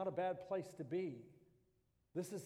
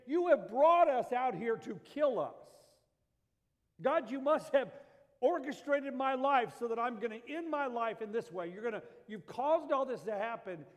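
loud, higher, accusing God of murder; accusing shout; 5 semitones higher, 8 dB louder than usual. Retold or imitated. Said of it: "They're saying".

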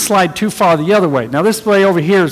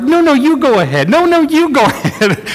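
high, gushing mock politeness; overly polite; 9 semitones higher than usual. Drivers leaving the event waving each other on, in high exaggerated politeness. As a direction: playfully insist the other car goes first. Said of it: "They were like".

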